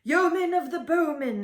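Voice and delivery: high, loud echoed call; chorus of lords. A narrator's reading. The lords and noblemen echo the king's summons, high and loud. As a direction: echo it like a court of nobles calling out. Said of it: "echoed all the lords".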